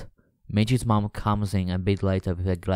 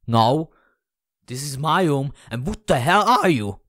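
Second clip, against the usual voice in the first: higher, indignant outburst; loud, rising confrontation; shouted demand; 6 semitones higher, 4 dB louder than usual. Acted. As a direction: shout in startled outrage. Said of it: "Someone had just shouted in my ear".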